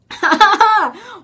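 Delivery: high, loud fake laughter; hammed-up laughing outburst; imitated forced laugh. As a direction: laugh desperately to keep from crying. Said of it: "and I start laughing".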